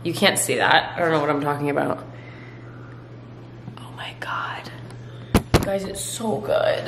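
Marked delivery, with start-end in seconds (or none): 3.8-6.9 breathy whisper of delight; whispered awe. Real, not acted. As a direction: whisper it like it's too good to say aloud. Tasting her pudding.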